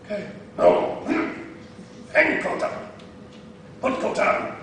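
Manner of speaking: terse broken English orders with sharp high "Ah"; Jackie Chan voice. Retold or imitated. Preacher imitating the master drilling the coat routine. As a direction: drill the student like a strict master. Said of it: "He's like".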